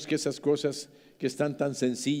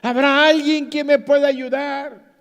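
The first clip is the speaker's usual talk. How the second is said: loud, high, more insistent call; calling for help; 10 semitones higher, 10 dB louder than usual. Retold or imitated. After silence the climber calls again, louder. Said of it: "Un silencio".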